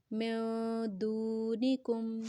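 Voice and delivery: "میں" and the "دو" of "دونکم" held long; slow chant-like "دونکم", last syllable simply joined; elongated recitation. Shown as a demonstration. Teacher gives the finished phrase to learners, elongated recitation. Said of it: "کاف کو simple میم کے ساتھ ملائیں گے".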